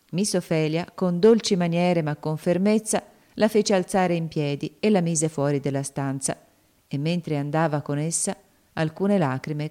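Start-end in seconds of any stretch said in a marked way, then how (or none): none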